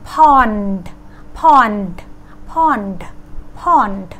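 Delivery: "pond" falling, with a released d at the end; final D sound. Shown as a demonstration. Teacher modelling the ending for learners, released D. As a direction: say "pond" and clearly let go of the d. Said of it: "ก็คือตัว D นะคะ".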